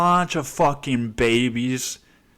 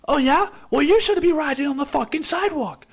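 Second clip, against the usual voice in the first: shrill, much higher mock rage, cracking into a cry; furious cyclist yelling. Angry cyclist screams at the other rider, shrill furious yell. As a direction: screech it like a petty road-rage fight. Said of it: "just be like".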